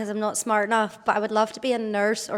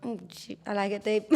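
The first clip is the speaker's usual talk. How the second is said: leering smutty joking; 8 dB quieter than usual. Real, not acted. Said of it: "sense of humor's coming out".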